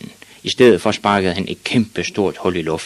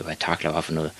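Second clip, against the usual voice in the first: ordinary delivery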